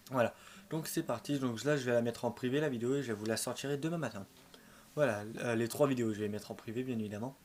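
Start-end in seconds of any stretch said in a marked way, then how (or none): none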